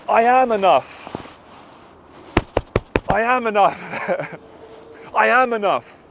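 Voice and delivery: slow, drawn-out impassioned affirmation; passionate. Real, not acted. Man affirming himself against the inner voice, slow and passionate. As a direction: stretch it out with conviction. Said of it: "I'm getting passionate".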